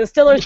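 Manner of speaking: "Stillers" with a short I; local pronunciation. Shown as a demonstration. Imitating local football fans, "Stillers" with short I. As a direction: say Steelers as "Stillers". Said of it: "Stillers, S T I L L E R S".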